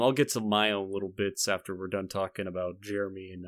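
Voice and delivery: normal talking voice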